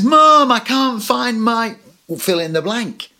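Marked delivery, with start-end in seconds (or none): 0.0-1.8 loud, high, drawn-out call to Mom; kid shouting downstairs. Acted. Kid upstairs calling down to Mom, high whiny shout. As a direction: yell it down the stairs like a stuck kid. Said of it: "they shout down and says".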